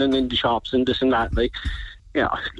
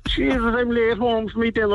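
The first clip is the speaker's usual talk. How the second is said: mock flustered local; 10 semitones higher than usual. Mimicking confused locals.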